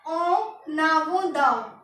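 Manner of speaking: ओ नावो द said very slowly, o naavo da; French en avant de. Shown as a demonstration. Teacher introduces the French for in front of, slowly. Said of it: "आता है हमारा next".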